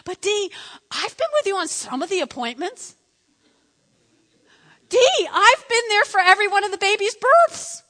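mock husband whine growing to a shout; pleading husband. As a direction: argue his case, voice climbing. Husband arguing his case.

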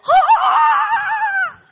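a loud, high, laughing "Ah!" of delight; hammed-up gleeful yelp; goofy delighted shout. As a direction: shriek with joy like God seeing you. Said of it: "I know I'm being a little goofy".

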